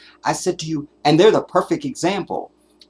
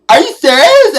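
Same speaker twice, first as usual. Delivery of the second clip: much higher, louder mocking dainty voice; dainty overpronounced son; 14 dB louder than usual. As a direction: ham up a prissy overpronouncing son. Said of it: "his son sounds like this".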